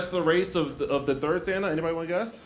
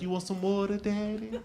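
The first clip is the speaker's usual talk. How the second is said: soft, sultry mock purr; a touch of a flirty nurse, lightly voiced; 4 semitones higher, 5 dB quieter than usual. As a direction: tease him as she offers the water. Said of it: "that's how she said it".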